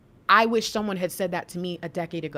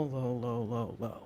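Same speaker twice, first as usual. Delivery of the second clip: sinking low; 11 semitones lower, 12 dB quieter, slower than usual. Pretend. Voicing the word low.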